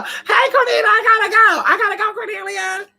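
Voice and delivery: high laughing singsong farewell; laughing mock goodbye. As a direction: mimic a giddy friend rushing off. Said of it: "let me go".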